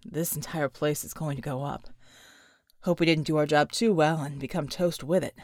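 low, tired soldier's voice; grim and worn down; weary character voice. Acted. John muttering to his team, low and exhausted. John's voice.

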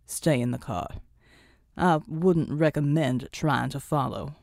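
a low hero's command, lightly voiced; low stern warning. A narrator's reading. Pride warning them to stay in the car, low and stern.

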